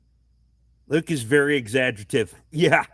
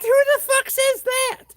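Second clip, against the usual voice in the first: much higher mocking squeak; mock squeaky voice; 4 dB louder than usual. Mocking the wife's sister on the phone, high squeak. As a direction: squeak it out in disbelief.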